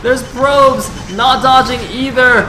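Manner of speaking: high, loud climactic esports call, easing at the end; full-voice battle commentary, slowly coming down; shouted play-by-play. Real, not acted. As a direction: shout the carnage in drawn-out phrases.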